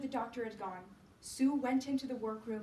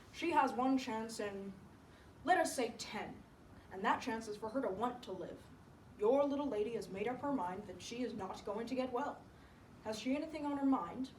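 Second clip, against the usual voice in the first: a hint of a grave doctor, measured; lightly voiced doctor. Doctor to Sue about her sick friend, lightly voiced. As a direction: deliver a prognosis in a lightly put-on doctor's voice.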